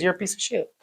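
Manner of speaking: hushed mock self-insult; undercutting inner voice. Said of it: "but your programming is".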